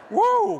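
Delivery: very high, loud "woo"; hammed-up whoop. Speaker showing off to working friends, exaggerated whoop. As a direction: let out a big shrill mock whoo of relief. Acting drained after being on stage.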